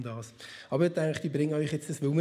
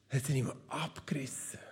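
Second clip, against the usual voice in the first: whisper; 6 semitones lower, 8 dB quieter than usual. Acted. Hushed reveal of the torn robe.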